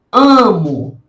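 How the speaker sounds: ordinary talk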